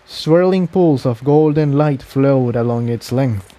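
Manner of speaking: plain reading voice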